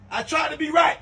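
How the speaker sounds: shouted plea. Acted. Shouting in defence.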